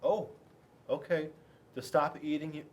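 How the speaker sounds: ordinary delivery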